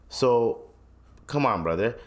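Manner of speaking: ordinary talk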